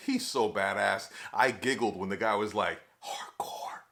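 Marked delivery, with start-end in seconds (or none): 3.0-3.8 husky awed whisper; voiceless, hushed imitation of an onlooker; hushed quote. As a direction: whisper it low, stretching both halves. Mimics guy's awed one-word reaction.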